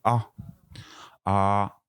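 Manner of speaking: open, clear A sound; vowel A. Shown as a demonstration. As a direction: show the mouth shape for A. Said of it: "regardez bien la, la position de la bouche". (voyelle A bien ouverte)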